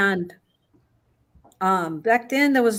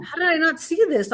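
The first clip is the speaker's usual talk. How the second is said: dismayed; 8 semitones higher than usual. Retold or imitated. Mother retells her own dismay.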